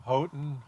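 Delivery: Houghton said HOH-tun; alternate pronunciation. Real, not acted. Naming towns in the storm's path, Houghton as HOH-tun. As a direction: say Houghton with a long O, no F. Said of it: "Let's just pronounce everything wrong".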